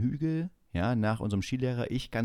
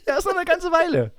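mock instructor; 7 dB louder than usual. Instructor quoted, voice put on.

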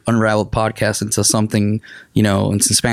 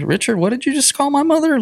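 mock offended. Acts out offended friend's reply.

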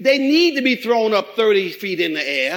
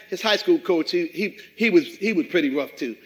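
usual speaking voice

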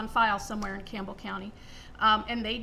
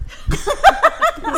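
high, loud breathy laugh; burst of laughter; 16 dB louder than usual. Real, not acted. [name], asked about the slaughterhouse, bursts out laughing loud.

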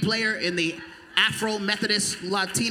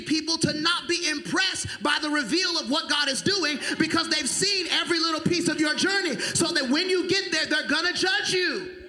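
full-force preaching; 10 semitones higher than usual. Acted. Preacher shouting at congregation about applause.

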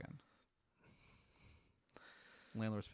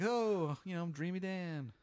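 giggly clown, pitched way up; Dreamy Dan; 8 semitones higher, 3 dB louder than usual. Acted. Clown introduces himself to the dreamer, high and giggly. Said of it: "he just giggles".

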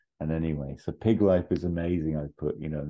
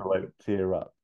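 ordinary delivery

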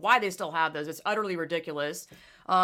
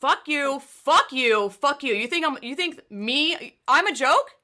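loud, higher angry tirade at the organizer; furious rant; 7 semitones higher, 5 dB louder than usual. Retold or imitated. She tells off the organizer in her head, furious rant. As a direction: tell him off, seething. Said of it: "I'm like".

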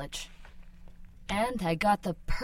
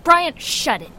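higher, louder snap; angry snap; 9 semitones higher, 11 dB louder than usual. Acted. Woman shutting down partner's flirting, angry snap. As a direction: cut him off hard.

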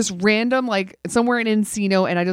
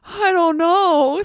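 pitiful weak reply; 8 semitones higher, much slower than usual. Retold or imitated. Husband weakly answering.

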